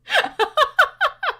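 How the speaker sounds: high cackle. Real, not acted. Streamer cackling at the ASMR sounds.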